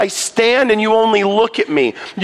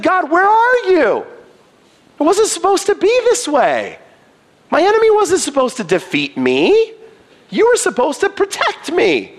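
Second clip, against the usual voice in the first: much higher, pleading, pained voice; David's anguished cry; 9 semitones higher than usual. Retold or imitated. David pleading with God, feeling abandoned.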